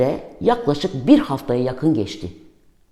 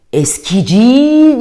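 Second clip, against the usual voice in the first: long, drawn-out high call, much louder; street vendor's cry; 9 semitones higher, 6 dB louder, much slower than usual. Retold or imitated. Junk dealer calling outside the window, a slow, loud high hawker's cry. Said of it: "diye bağırıyor".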